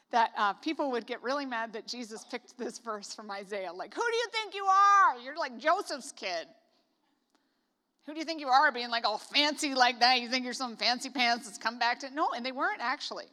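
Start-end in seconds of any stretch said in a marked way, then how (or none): 3.9-12.1 loud, indignant mock heckle; mock outraged heckler; mocking crowd. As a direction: scoff at him like an offended townsman. Crowd voiced mocking Jesus.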